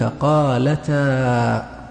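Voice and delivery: slow قالتا, open a after the t; fatha on the ta. Shown as a demonstration. Teacher giving an example of fatha, قالتا stretched with an open "taa". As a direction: draw قالتا out as "qaalataa". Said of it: "أحيانًا التحريك يكون بالفتح".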